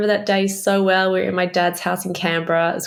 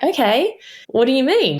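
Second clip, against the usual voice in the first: surprised; 7 semitones higher than usual. Retold or imitated. Retold reaction to twins hint.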